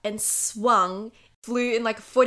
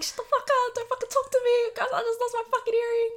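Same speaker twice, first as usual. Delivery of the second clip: snapping at guys; faster than usual. Retold or imitated. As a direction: bite their heads off in a high imitated voice. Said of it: "full shut them down".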